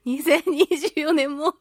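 ordinary talk, nothing marked

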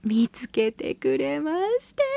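ordinary talk, nothing marked

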